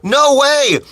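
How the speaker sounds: high, loud burst of surprise; amazed delight. Real, not acted. Streamer reacting to a viewer's message, amazed delight. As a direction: exclaim as if thrilled by the news.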